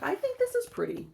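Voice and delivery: high squeal of delight. Real, not acted.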